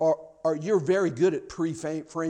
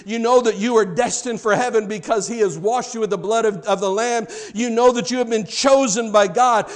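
louder, building sermon cadence; preaching at full force; 4 semitones higher, 7 dB louder than usual. Acted. Pastor assuring the congregation of God's favor, fervent preaching. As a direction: preach it, rising with conviction.

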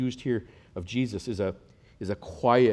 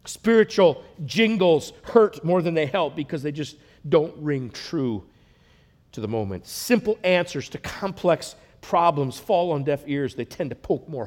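rising, loud, higher preaching build; preaching at full force; 5 semitones higher, 6 dB louder than usual. Acted. Builds to loud, high preaching, slowing down. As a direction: pastor to congregation, preaching louder and higher.

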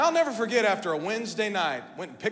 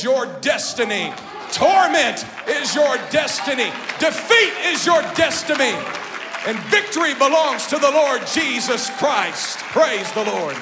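much louder, higher shouted preaching; full-force preaching shout; 6 semitones higher, 11 dB louder than usual. Acted. Preacher shouting down the devil before the church, full-force shout. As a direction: roar the devil's doom at him.

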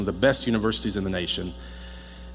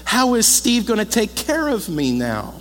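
higher, slower, hammed-up wail; mock bawling; 11 semitones higher, 5 dB louder than usual. Pastor imitating a weeping mother, mock wailing. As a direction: play the mother as a comic crybaby. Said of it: "She was bawling".

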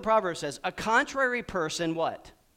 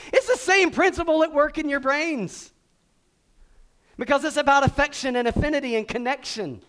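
preaching at full force; 7 semitones higher, 6 dB louder than usual. Real, not acted.

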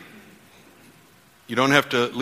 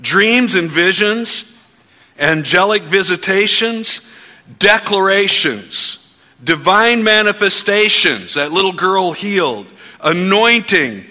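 preaching declarations; 4 semitones higher, 4 dB louder than usual. Acted. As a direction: proclaim it over the church at full force. Leads congregation in faith declarations.

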